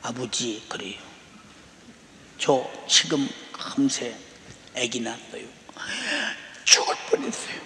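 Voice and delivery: retold daughter, soft, low and halting after crying; 울음 끝에 진정한 말투. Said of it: "진정하고 말하는데". (울다가 겨우 진정하고 낮고 조용하게 띄엄띄엄)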